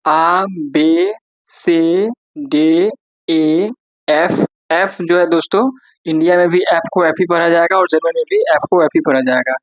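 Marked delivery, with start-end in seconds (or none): 0.1-4.5 slow letter-by-letter German names आ, बे, से, डे, ए, एफ; German alphabet recital. Shown as a demonstration. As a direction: recite each German letter slowly and clearly. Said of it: "हम पढ़ के दिखाते हैं आपको German में".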